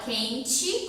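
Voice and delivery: "quente" pronounced with an i sound at the end; final E as I. Shown as a demonstration. Teacher explaining spelling to children, final E as I. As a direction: end "quente" on "chi", not "te". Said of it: "como se fosse um i no final".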